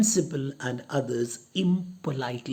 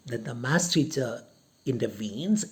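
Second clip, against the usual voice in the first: ordinary talk, nothing marked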